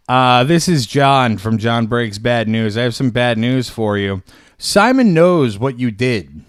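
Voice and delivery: loud ominous threat; menacing loud message. Acted. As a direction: boom it like a movie villain. Menacing voicemail left loudly.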